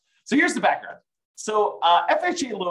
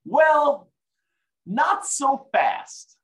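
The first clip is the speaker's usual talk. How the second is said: much higher, very slow teasing drawl; dramatic not so fast; 13 semitones higher, 5 dB louder, much slower than usual. Acted. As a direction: stretch it out, teasing. Dramatic turn.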